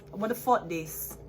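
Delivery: ordinary delivery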